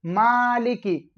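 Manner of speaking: मालिकी with a long held "maa", then "li-ki"; slow model reading of मालिकी, ending on i; correct long vowel. Shown as a demonstration. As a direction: draw out the first vowel of मालिकी slowly. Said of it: "असल में लफ्ज क्या है".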